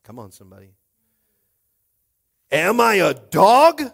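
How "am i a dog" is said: loud, high, drawn-out roar. A narrator's reading. Goliath insulted by the boy David, roaring. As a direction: roar the challenge as an insulted giant. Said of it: "he roared at David".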